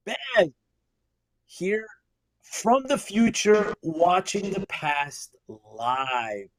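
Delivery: high, drawn-out hail to a listener; mock-grand introduction, slowing as it falls; playful announcer hail. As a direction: start high and stretch it slowly to the last word. Host hails a listener, announcer-style.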